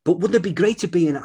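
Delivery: a hint of a wistful star; lightly voiced Brad Pitt. Voicing Brad Pitt's wish.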